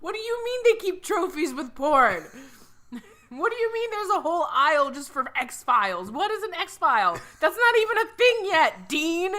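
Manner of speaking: high, loud alien disbelief, laughing. Retold or imitated. Aliens interrogating Dean, loud high laughing voice. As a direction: pile on the questions in disbelief.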